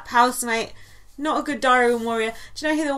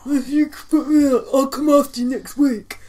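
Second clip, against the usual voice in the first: higher, louder sobbing threat; crying threat; 4 semitones higher, 6 dB louder than usual. Retold or imitated. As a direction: blubber the threat through tears. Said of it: "And then he went".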